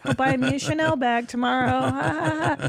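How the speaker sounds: slow, laughing put-on voice; mock smug reply. Wife joking she'll be fine with a slow smug laugh. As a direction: brush it off with a smug grin. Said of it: "And I was like".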